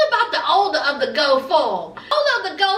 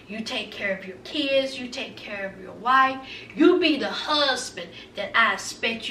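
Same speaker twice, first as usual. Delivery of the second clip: soft, low, soothing mother voice; tender reassurance; 9 semitones lower, 8 dB quieter than usual. Retold or imitated. Imagined mama comforts her crying son, tender reassurance. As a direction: comfort him gently, hushed and low.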